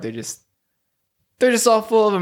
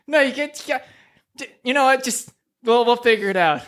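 a touch higher, fed-up voice; exasperated sidekick; 8 semitones higher than usual. Acted. Alfalfa giving up on teaching Gerald, mildly fed up. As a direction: give up on him kindly.

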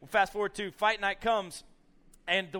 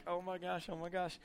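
soft imitation of worried onlookers; hushed alarm; 6 dB quieter than usual. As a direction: murmur it in dread. Retold onlookers' hushed worry.

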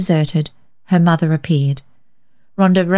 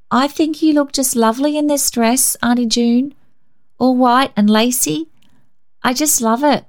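put-on much higher, sweet little-girl compliments; butter her aunt up; girl sweetly flattering Auntie June's dress; flattering child; 7 semitones higher than usual. A narrator's reading.

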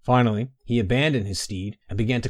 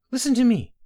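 much higher, louder call of a name; 14 semitones higher, 5 dB louder than usual. Acted. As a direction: call to him through the door. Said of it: "called Baynara".

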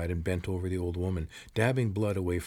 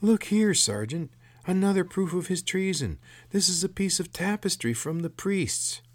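much higher, shrill underling; 11 semitones higher, 4 dB louder than usual. A narrator's reading. Corporal showing the sergeant proof of treason, shrill. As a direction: pipe up shrilly to the officer. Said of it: "spoke in a shrill voice".

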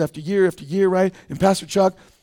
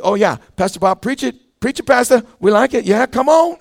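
higher and louder, hyped-up amen corner; excited cheering; 4 semitones higher, 5 dB louder than usual. Retold or imitated. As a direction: cheer the pastor on like you're fired up. Churchgoer cheering the preacher.

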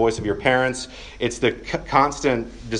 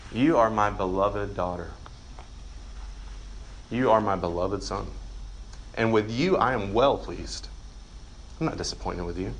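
soft, low and gentle, a loving father speaking; hushed, tender reassurance from a father; God's tender voice; 3 semitones lower, 6 dB quieter than usual. Acted. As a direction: speak it as God's quiet blessing over a child. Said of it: "to hear God saying to you".

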